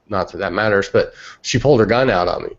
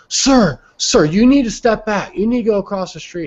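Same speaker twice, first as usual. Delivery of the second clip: high, raised voice of a cop giving orders; mock cop order; 11 semitones higher, 3 dB louder than usual. Cop orders him back across the street, high and commanding. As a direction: imitate an insistent cop, pitched way up.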